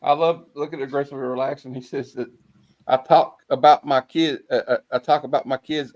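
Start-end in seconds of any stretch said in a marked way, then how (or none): none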